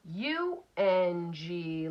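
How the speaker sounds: each letter said slowly and separately; slow letter spelling. Shown as a demonstration. Teacher spelling U N G slowly for students. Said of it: "spelling letters for decoding".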